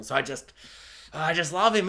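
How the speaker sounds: usual speaking voice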